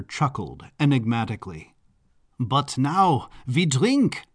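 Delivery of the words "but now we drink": slow, drawn-out Baron's proclamation; grand proclamation. Acted. Baron calling his guests to drink, slow proclamation. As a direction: proclaim it slowly to the room. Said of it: "the Baron proclaimed".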